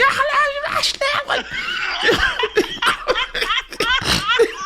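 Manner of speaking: high, garbled German yell with laughter; mock German yelling. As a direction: imitate frantic yelling in gibberish German.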